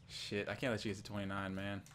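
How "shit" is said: ordinary talk, nothing marked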